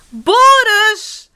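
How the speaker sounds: very high, loud urgent yell; little sister's escalating cry for help. A narrator's reading. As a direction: shout the name with full alarm, stretched out. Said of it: "roept zusje Sam".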